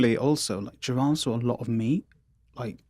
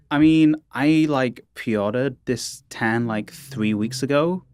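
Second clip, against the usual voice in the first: hammed-up Valley girl, slow and drawn out; bad Valley accent; much slower than usual. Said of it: "a very bad stereotypical Valley accent".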